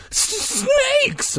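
high, stammered cry of alarm; stammering. A narrator's reading. Mike warning his friend of snakes in his bed, stammering. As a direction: stutter on the first sound, stretching it out. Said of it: "Mike stammered".

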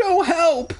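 high, loud screech; panicked screeching call; screeched cry for help. A narrator's reading. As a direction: shriek it in panic. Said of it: "I screeched".

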